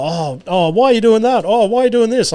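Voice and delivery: high, whiny mock of the wife's backseat questions; mimicking nagging wife. Husband mocks wife nagging his driving, high whiny imitation. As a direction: pitch it up and whine each why.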